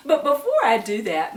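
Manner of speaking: ordinary talk, nothing marked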